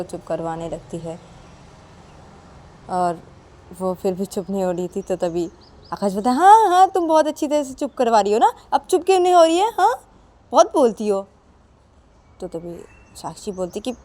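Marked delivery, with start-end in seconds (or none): 6.4-11.2 higher, louder sarcastic taunt; husband mocks wife when the baby keeps crying; mocking husband. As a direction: needle her mockingly. Akash mocking Sakshi.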